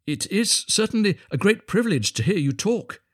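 high, admiring little fellow; humble little Hans. A narrator's reading. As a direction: praise him meekly, pitched high.

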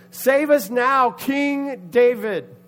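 high, loud, slow chant; acted raucous rallying cry; raucous shout. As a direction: stretch out each word in a crowd yell. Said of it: "getting a little more raucous".

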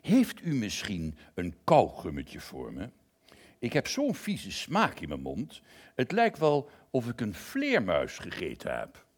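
a hint of a lion asking for gum; lion's voice. Lion asks the lost narrator for chewing gum, lightly voiced.

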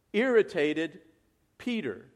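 ordinary talk

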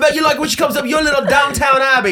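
shouting in a put-on British accent; mock British accent. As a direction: taunt him in a cartoon British accent. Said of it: "what happened to your accent".

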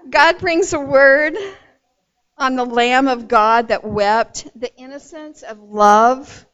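loud, ringing preacher's cadence; full-voiced sermon delivery building to amen; preaching. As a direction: preach it out to the congregation.

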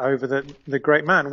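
usual speaking voice

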